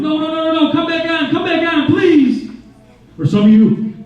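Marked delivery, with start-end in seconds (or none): 0.0-2.5 high, whiny begging for the heat; mock whine, much higher; pleading with the heater. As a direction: beg the heater to come back on. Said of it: "you're like".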